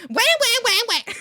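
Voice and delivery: very high, loud mocking wail; exaggerated crybaby imitation; mock whining. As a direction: throw the whining back at them. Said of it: "That's you all the time".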